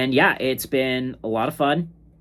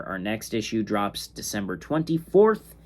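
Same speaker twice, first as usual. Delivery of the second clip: ordinary talk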